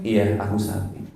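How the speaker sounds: soft, submissive mock agreement; soft low-key surrender; meek wife. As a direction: give in quietly, playing the meek wife. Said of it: "langsung ibu bilang gini".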